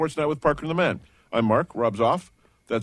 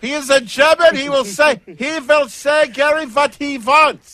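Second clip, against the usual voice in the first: loud, high, hammed-up German accent; 12 semitones higher, 8 dB louder than usual. Host defending the German coach to caller Gary, mock German accent. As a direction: shout it slowly in a cartoon German accent. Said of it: "stereotypical German accent".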